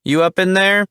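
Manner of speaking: loud, high call across the yard; yelling. A narrator's reading. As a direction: shout the name out. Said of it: "she yells".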